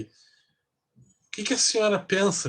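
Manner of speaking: high, slow, hands-on-hips challenge; mock the roommate demanding an answer; confrontational mock. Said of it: "botando a mão na cintura".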